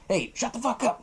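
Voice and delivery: lightly acted tough-love dad telling off his son. Tough-love dad telling his son to shut up, mock scolding. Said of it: "the tough love dad".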